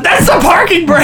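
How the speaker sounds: very high-pitched comic outcry; mock exclamation. Podcaster acting the clueless co-pilot during a drift, mock outcry.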